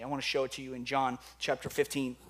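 usual speaking voice

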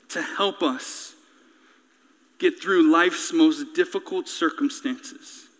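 low, measured closing prayer. Real, not acted. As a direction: pray quietly and earnestly for the congregation.

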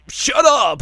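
high, loud mock yell; hammed-up shouted shut up; yelled Inuyasha. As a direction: snap back hard, loud and high. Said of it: "And Inuyasha's like".

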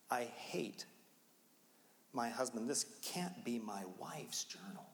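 hushed whisper of disbelief; shocked whisper. Retold or imitated. Husband whispering in shock over his wife's journal, hushed. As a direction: breathe the words out in shock.

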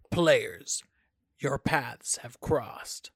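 slow, portentous board game narrator. Shown as a demonstration. Demonstrating the narrator for a board game idea, slow narrator voice. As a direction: announce it slowly like a fantasy game narrator. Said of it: "a really good narrator voice".

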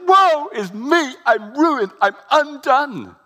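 high, loud, slow cry of woe; despairing Isaiah. Acted. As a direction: wail it out, undone before God. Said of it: "He says".

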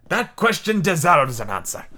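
put-on lilting, hammy foreign accent for a sea captain; theatrical captain with an unplaceable European accent; mystery foreign accent. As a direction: explain it in a wandering, vaguely French accent. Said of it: "I don't know what accent this is".

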